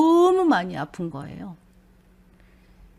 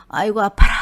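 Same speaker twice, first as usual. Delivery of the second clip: louder, pained cry of ouch; loud pained groan; 9 dB louder, faster than usual. Retold or imitated. Picking up her brother's call, a loud pained groan. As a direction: let the pain burst out loud. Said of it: "먼저 입에서 이렇게 나와서".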